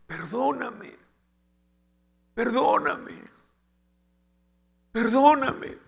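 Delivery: high, rising cries for forgiveness; retold pleading. Husband calling from kitchen for wife's forgiveness, pleading.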